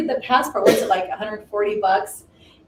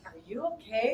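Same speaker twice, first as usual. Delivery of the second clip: concerned; 9 dB quieter than usual. Retold or imitated. Customs officer concerned at tears.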